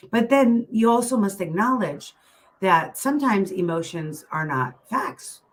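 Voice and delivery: ordinary talk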